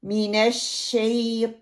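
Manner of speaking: slow मिनश शैता, held SH, rounded TAW; heavy taw. Shown as a demonstration. Teacher modelling मिनश शैता for learners, drawn out. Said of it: "तौ को अदा करते हुए".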